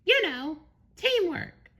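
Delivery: very high, chipper cartoon voice; SpongeBob. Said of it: "he exclaimed".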